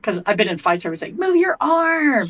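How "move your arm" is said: high, slow, hammed-up yell of "Move your arm"; mock coach yell. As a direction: bark it like a sideline coach, much higher. Mock coach yelling from outside.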